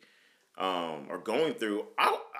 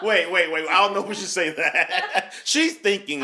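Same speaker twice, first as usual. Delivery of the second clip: talking through real laughter, higher and louder; laughing; 5 semitones higher, 5 dB louder than usual. Real, not acted. Host teases Jessica about not thinking, laughing. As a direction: tease her while you can't stop laughing.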